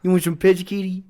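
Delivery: hammed-up cat-lover impersonation; Bubbles. Shown as a demonstration. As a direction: talk through an underbite, coaxing a cat. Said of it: "You gotta do the underbite to do it".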